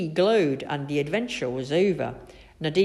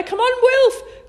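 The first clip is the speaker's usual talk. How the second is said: high, loud, drawn-out call; urgent call; 9 dB louder, slower than usual. A narrator's reading. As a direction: call a friend across the gap. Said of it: "called Biff".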